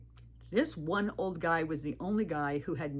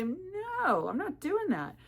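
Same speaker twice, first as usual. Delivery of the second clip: firm refusal; 13 semitones higher, slower than usual. Retold or imitated. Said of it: "I had to get firm and say".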